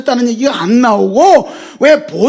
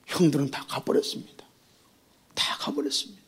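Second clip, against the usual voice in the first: falling to a whisper; hushed; 10 semitones lower, 14 dB quieter than usual. Real, not acted. Preacher telling the brothers left, sinking to a whisper. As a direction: let it fade to a whisper. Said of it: "preacher drops to whisper".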